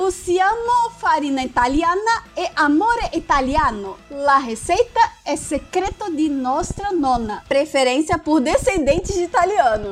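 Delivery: hammed-up Italian accent; mock Italian reading a job ad; Italian accent portuguese. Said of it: "fazer o sotaque italiano".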